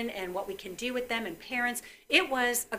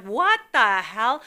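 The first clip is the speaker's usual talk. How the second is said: loud, drawn-out startled outburst; retold shock; 11 dB louder, much slower than usual. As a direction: re-enact a shocked mother, slow and loud. Mother's startled reaction, retold.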